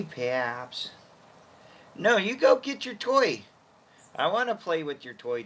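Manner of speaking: high pet-talk voice; high calling voice for the dog. Real, not acted. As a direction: coo at the puppy.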